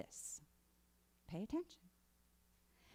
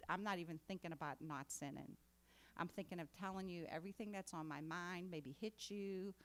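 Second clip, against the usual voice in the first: usual speaking voice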